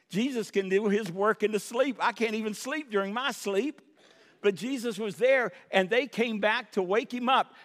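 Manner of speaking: ordinary delivery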